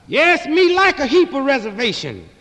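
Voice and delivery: mock a slow, eager-to-please interviewee; mock Uncle Tom interviewee. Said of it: "some old Uncle Tom Tom Indian".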